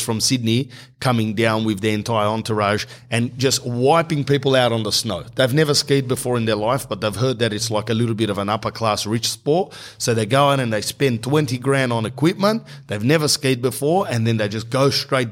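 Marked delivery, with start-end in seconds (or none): none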